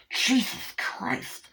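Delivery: high, slow drawn-out oath; mock startled cry. John Waters turning round, startled mock cry. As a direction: exclaim in exaggerated shock.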